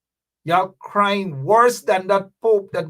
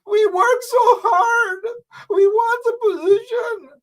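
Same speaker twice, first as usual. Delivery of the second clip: hammed-up blubbering whine; mock crying. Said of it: "y'all coming out telling people".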